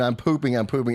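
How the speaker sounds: ordinary delivery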